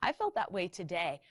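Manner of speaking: ordinary delivery, nothing marked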